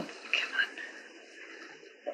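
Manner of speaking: ordinary talk, nothing marked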